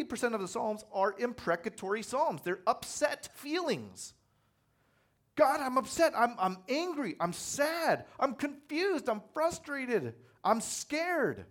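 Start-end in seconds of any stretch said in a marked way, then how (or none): 5.4-11.4 anguished prayer. Acted. Voicing an upset psalm prayer.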